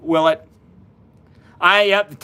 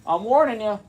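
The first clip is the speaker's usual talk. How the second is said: a lightly voiced spooky warning, higher; mock ominous warning; 6 semitones higher than usual. Host teasing live viewers before the camera flips, mock ominous. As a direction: tease the viewers with a playful threat.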